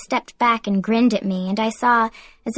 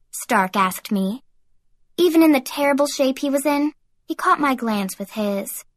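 ordinary delivery, nothing marked

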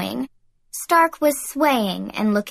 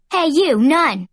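loud, higher-pitched hail; yelled; 5 semitones higher, 5 dB louder, slower than usual. A narrator's reading. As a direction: call out bossily to a passing nun. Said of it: "she yelled at one of the nearby".

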